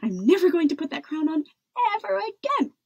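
fast, high defiant vow; shouting back. A narrator's reading. Princess refusing to wear the crown, shouting back. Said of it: "Isabella shouted back".

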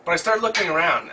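ordinary talk

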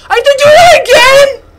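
high, loud whimpering protest; whining. Real, not acted. Streamer whining about redoing the level. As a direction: whine like near tears.

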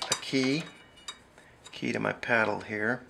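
ordinary delivery

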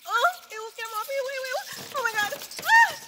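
high frantic shrieking; panicked. Acted. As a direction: scream as bats swarm you. Bats flutter all over her.

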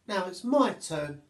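lightly voiced monkey ready for her turn; eager little monkey.

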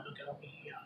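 hushed unvoiced whisper; breathy hushed aside. Real, not acted. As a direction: whisper it to the camera. Showing food to camera.

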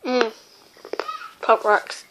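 talking while eating candy; with candy in mouth. Real, not acted. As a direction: mouth holding small sweets. Seen 2: Puts small candy pieces into mouth with fingers; no stick seen.

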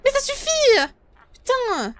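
frightened angry shout, high; scared yelling. Real, not acted. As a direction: yell out in fright. Scared again by the monster.

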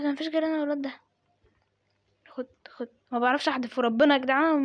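ordinary talk

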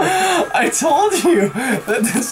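slow, high teasing laugh; gloating through laughter. Real, not acted. Teasing the scared friend.

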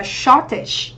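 "shortage" stressed on the first syllable, short; teacher modelling SHORT-age. Shown as a demonstration. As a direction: put the weight on the start of "shortage". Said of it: "danh từ trọng âm một".

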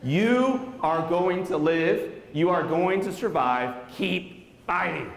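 slow, spaced-out quoted command; emphatic urging. Retold or imitated. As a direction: stretch each phrase to will a boy to live. Jack Buck urges boy to live.

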